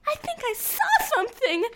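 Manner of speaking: high-pitched, spooked imitation; mock-frightened friend. Scared friend whispers of a sighting to classmates, high mock fright. Friend startled seeing something.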